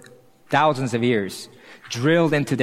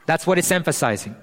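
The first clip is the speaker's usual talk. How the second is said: ordinary talk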